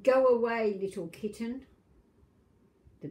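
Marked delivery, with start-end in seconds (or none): 0.0-1.7 a big cat shooing, loud start. Acted. Grandma reading, cat guards its milk, loud stern voice. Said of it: "said a big cat".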